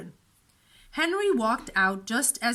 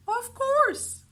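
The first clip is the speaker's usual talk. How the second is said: kind librarian; 13 semitones higher than usual. A narrator's reading. Librarian voiced.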